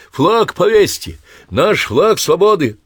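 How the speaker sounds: put-on high, loud, slow drunken yelling; excited shout. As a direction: bellow it like a tipsy patriot. Drunk flag-bearer rushes soldiers.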